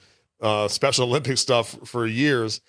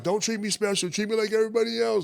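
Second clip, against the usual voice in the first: quoting cousin; 9 semitones higher than usual. Retold or imitated. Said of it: "he was like".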